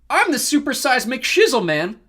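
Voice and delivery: high, loud, drawn-out self-introduction; swaggering brag. A narrator's reading. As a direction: announce yourself like a superstar. Leo brags to Narcissus.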